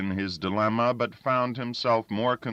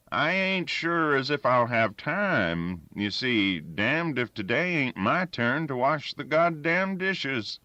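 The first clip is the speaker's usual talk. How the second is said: lightly voiced sly old woodsman, a bit higher; crafty old Danny; 7 semitones higher than usual. A narrator's reading. Old Danny angling to dodge the dishes, a touch sly. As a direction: stall for time like a wily old-timer.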